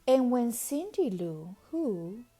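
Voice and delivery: ordinary delivery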